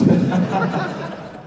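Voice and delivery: short rising laugh; burst of laughter. Real, not acted. Laughing at own story.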